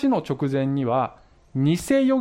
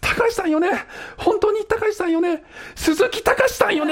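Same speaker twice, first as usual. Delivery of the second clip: heated-up caller. Retold or imitated. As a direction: push the question to its peak. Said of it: "お互いすっごいヒートアップしてきて". (最高潮に興奮して甲高く問い詰める女性)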